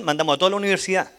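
usual speaking voice

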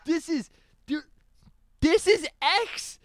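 high, slow, loud disbelief; amazed insistence. Retold or imitated. Host marvelling who the artist is, high incredulous exclamation.

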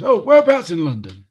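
high, loud manic question; Doc Brown impression. The Doc Brown actor quizzing the tourists, manic loud voice. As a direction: bounce it up like a wild-eyed scientist. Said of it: "He goes".

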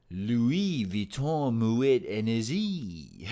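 slow, hammed-up French accent on Louis Vuitton Moet Hennessy; exaggerated French accent, drawn out slowly. Shown as a demonstration. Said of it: "It's the best French accent I got".

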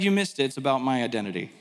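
lightly voiced rebuke, dropping low; Jesus. Jesus tells the arguing crowd they missed it, low mild rebuke. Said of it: "And Jesus is like".

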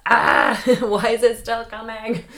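loud, high exasperated yell breaking into a laugh; half-laughing hammed-up yell of frustration; mock yell. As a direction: shout it at the bad luck that keeps coming, then laugh. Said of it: "I just yelled in the mic".

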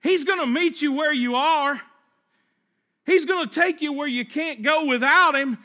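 high, forceful preaching voice; strengthened voice. Acted. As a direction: swell to a confident declaration. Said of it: "The voice gets a little stronger".

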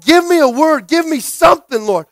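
loud, high pleading cry; urgent plea to God. Acted. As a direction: cry out for a word from heaven. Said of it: "you're asking God".

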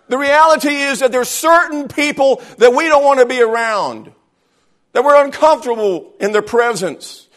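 high, loud pulpit preaching; full-force preaching. Acted. Pastor preaching hard to his church about loving people. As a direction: drive it home to the congregation.

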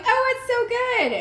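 high, slow, gushing fake praise; mock delight. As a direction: coo it like a polite guest pretending. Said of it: "they're like".

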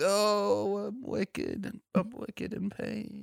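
high, soft, drawn-out mock moan; moaning ghost. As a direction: wail softly like a comic Boston ghost. Acts out the groaning ghost.

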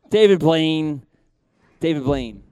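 loud, hammed-up scolding; mock outrage. Spectator reels at the magician's trick, loud mock outrage. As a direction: exclaim the name in pretend shock.

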